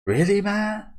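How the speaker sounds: hammed-up incredulous friend; surprised. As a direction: pop up in happy shock. Reading an excited friend's line.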